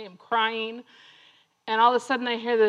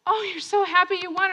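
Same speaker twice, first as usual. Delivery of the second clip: imitated delight; 6 semitones higher than usual. Husband greets his sobbing runner.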